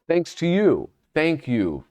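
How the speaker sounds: ordinary talk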